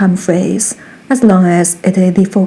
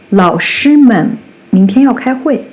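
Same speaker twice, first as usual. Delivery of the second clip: normal talking voice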